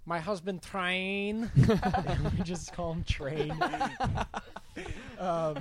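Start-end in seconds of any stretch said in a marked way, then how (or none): 0.1-3.6 slow, higher Romanian accent imitating the teacher; teacher's Romanian accent. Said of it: "but she'd be like".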